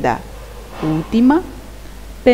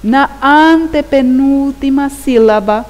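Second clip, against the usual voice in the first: antepenúltima hammered out higher and louder; emphatic stress; 5 semitones higher, 6 dB louder than usual. Shown as a demonstration. Teacher giving pupils the answer, antepenúltima loudly emphasised. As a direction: stretch and punch each stressed syllable of antepenúltima sílaba.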